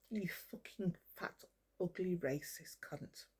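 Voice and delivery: hushed, seething slur at her enemy; slow quiet contempt. Real, not acted. Insulting Lee.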